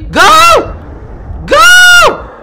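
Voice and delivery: high, loud frantic yell; shouting. Real, not acted. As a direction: scream go at full force. Frantic escape in game.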